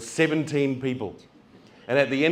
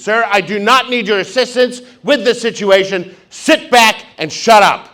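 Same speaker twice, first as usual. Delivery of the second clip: snapping stewardess; 8 semitones higher, 10 dB louder than usual. Retold or imitated. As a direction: shut him down like a curt stewardess. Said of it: "And she snaps at me".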